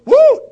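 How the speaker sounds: sudden high whoop; joyful woo. Retold or imitated. Pastor whooping in worship, sudden high and loud. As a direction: belt out a happy woo. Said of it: "Pastor Brandon goes".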